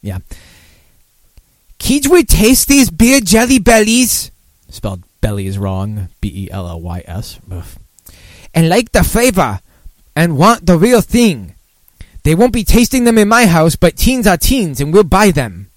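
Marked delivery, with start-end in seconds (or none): 1.8-4.3 loud, high-pitched, drawn-out cartoon voice; high mocking cartoon impression; Homer Simpson. 8.5-15.7 high, loud cartoon read-out; exaggerated high impression, stretched at the ends; Homer Simpson.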